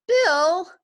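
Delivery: high, loud cry of his name; wife crying out to husband; alarmed call. A narrator's reading. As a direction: call him back in alarm.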